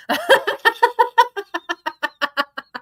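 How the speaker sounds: a high, real burst of giggles; laughing helplessly at a funny text; giggling laugh. Real, not acted. Vlogger cracking up at her friend's joke, high giggling laugh. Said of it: "Sorry, that was funny".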